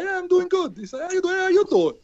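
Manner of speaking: high Queens Italian-American "how you doing"; New York Italian accent. Retold or imitated. Greeting people on the street in a New York Italian accent. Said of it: "if I do the accent".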